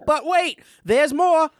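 Billy Mays catchphrase, high and punchy; infomercial pitchman. Retold or imitated. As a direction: sell it like a TV pitchman. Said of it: "like, catchphrase".

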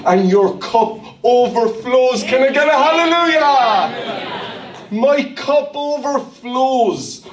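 drawn-out lifted preaching cry; rousing preaching. Acted. Preacher calling to the congregation, soaring and drawn out. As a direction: stretch it out to lift the room.

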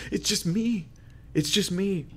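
high, forlorn "poor me" voice; self-pitying lament. Acted. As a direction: play the lonely martyr, pitched high. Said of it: "That's how he felt".

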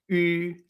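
Ü, lips rounded for U, tongue saying I; sustained French Ü; U francesa. Shown as a demonstration. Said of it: "Lo que llamamos la U francesa". (Ü como la U francesa)

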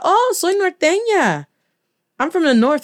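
high, sing-song imitated answer; playfully acted evasive reply; retold coy reply. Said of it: "And I was like".

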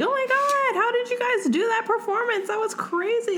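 high, gushing starstruck fan voice. Retold or imitated. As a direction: squeal it like an amazed fan. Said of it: "And they're like".

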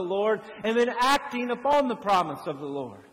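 fervent preaching. Acted. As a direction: build it up and press it on the congregation. Preacher climbs again on promise.

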